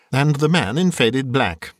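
ordinary delivery

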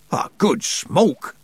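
slow, high-pitched astonished curse; swearing oath. A narrator's reading. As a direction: swear in drawn-out shock. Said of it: "he swore".

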